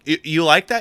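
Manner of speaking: high, loud stammered check; mock nervous. Scared buyer checks with the maker, mock nervous. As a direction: seek approval, hammed-up and anxious. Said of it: "I was so scared".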